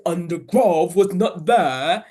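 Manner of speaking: slow, loud, gruff monster voice; deep gruffy voice. Shown as a demonstration. Parent reading the Gruffalo to children, slow loud gruff voice. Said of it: "you have to use a deep voice".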